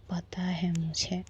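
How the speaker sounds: slow, hushed apology; soft whisper. Acted. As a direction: whisper it close, slowly. Apology in an embrace.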